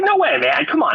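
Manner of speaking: can't believe what the friend says; incredulous disbelief. Retold or imitated. Telling his friend on the phone he can't be in Wasilla, high incredulous. Friend claims he was elsewhere.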